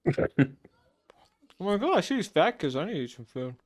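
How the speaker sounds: talking while chewing popcorn; words around a mouthful of popcorn; munching popcorn. Real, not acted. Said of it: "I'm eating popcorn right now".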